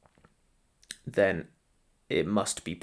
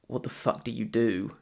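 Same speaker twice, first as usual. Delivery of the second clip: ordinary talk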